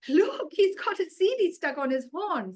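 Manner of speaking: put-on boy points out the stuck disc; amused boy. Faizan teases about the goat's horn, amused.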